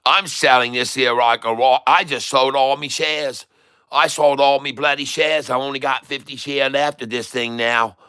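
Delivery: lively put-on Aussie trader; Australian accent. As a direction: brag about the sale in Australian. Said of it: "talking like this to all the blokes down in Australia".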